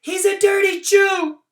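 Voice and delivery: put-on very high, loud girl's scream; girl screaming an accusation, very high and loud; screaming little girl. A narrator's reading. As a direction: scream it at him like a cruel child. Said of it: "pointing and screaming".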